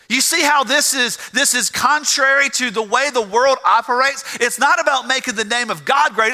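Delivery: high, driving pulpit voice; impassioned preaching. Real, not acted. Preacher contrasting hypocrites with true prayer, impassioned preaching. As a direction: pile up the phrases with mounting fervor.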